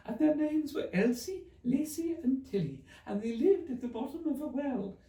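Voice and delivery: a sleepy dormouse rushing his tale. Acted. Dormouse tells story.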